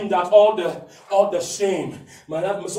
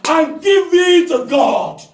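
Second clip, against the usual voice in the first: loud, higher, slow emphatic sermon; preaching at full force; 7 semitones higher, 5 dB louder than usual. Acted. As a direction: drive it home with fire.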